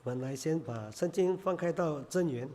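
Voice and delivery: normal talking voice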